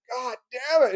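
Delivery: high, mock exasperated curse; retold burst of anger; angry outburst. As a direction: act out losing your temper for a moment. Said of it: "when I got angry".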